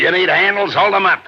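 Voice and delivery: loud and high barked command; shouted order. Acted. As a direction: order your man sharply. Max barks orders at thug.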